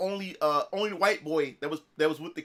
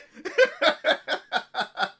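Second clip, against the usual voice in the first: high, staccato real laughter; laughing; 9 semitones higher, 4 dB louder than usual. Real, not acted. Streamer remembering the nervous dad, high laughter. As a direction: crack up at your own story. Laughing at his own story.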